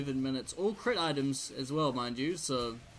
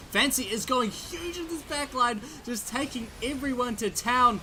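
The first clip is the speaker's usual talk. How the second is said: rising high, loud caster hype; high, loud esports caster hype; excited play-by-play; 9 semitones higher, 4 dB louder than usual. Acted. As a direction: call the teamfight with building excitement. Excited team fight call.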